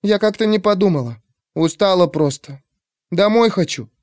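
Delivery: put-on high, sniffly tired girl. A narrator's reading. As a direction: apologize like a weary child.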